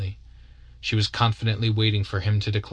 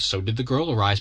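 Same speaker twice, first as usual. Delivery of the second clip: plain reading voice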